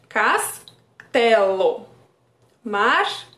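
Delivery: very slow, each word stretched so the shared ending rings out; drawn-out rhyme demo. Shown as a demonstration. Therapist stretching the words so the child hears the rhyme, very slow. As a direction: linger on every syllable of "Castelo" and "martelo". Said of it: "o mesmo som final".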